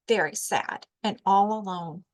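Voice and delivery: ordinary delivery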